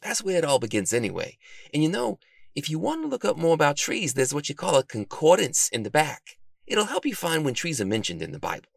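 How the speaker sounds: helpful bookworm. A narrator's reading. Bookworm shows the detective how to begin, helpful.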